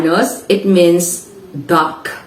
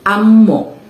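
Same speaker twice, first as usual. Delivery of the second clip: "amo" stressed on the opening "a"; first-syllable stress; 3 dB louder than usual. Shown as a demonstration. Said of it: "stressed on the first syllable".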